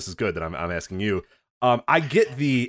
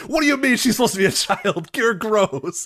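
a touch higher, indignant sneer; mock offended tweeter; 10 semitones higher than usual. Imitating an offended roleplay account, lightly mocking. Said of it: "then saying".

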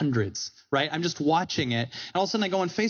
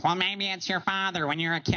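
Louie Anderson; 4 semitones higher than usual. Retold or imitated. Comic imitates his comedian mentor offering advice, in a higher put-on voice. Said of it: "That's how he sounds".

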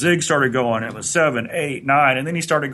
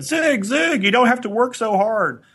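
high, urgent plea to stop, retold; alarmed call; 9 semitones higher than usual. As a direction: call out in alarm to the straining lifter. Trainer alarmed as Zig strains.

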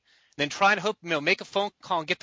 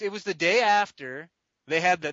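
ordinary talk

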